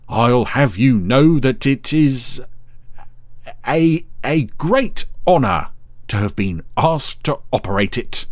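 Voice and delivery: slow, proud and pompous; proudly. A narrator's reading. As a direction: puff up with pride. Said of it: "he said proudly".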